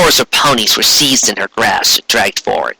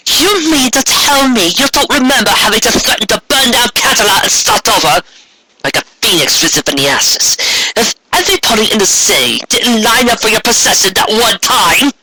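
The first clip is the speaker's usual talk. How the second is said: higher, scornful reproach; accusing sister; 10 semitones higher than usual. Acted. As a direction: remind her of her worst deeds with contempt. Luna accuses Corona.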